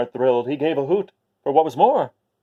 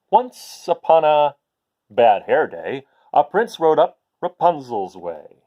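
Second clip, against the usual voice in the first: lively, louder storybook read-aloud; reading to children; 4 dB louder than usual. A narrator's reading. Teacher reading a picture book to her class, lively and loud. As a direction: read it out big for the kids.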